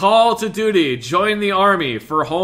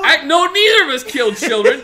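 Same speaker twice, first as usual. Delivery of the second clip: laughing; 9 semitones higher, 5 dB louder, faster than usual. Real, not acted. Defending in game, laughing.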